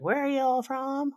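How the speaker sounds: lightly put-on American twang; Montana accent. Montana locals asking Canadians, mock American accent. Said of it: "they're like".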